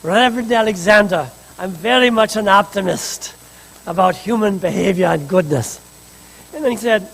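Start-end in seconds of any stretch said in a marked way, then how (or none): 0.0-5.8 put-on light Polish accent, quoting an elderly professor fondly; Samuel Oleiner's Polish accent. Minister retelling the professor's phone call, in a light Polish accent. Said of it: "I'm gonna try to do his little accent".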